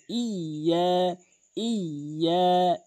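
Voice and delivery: ইয়া very slow, doubled. Shown as a demonstration. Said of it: "double উচ্চারণ হবে". (ইয়া double করে ধীরে)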